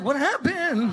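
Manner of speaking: high, hammed-up innocent question; mock nervous kid. Child asking the angry parent, high mock-nervous voice. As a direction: squeak it out like a kid in trouble.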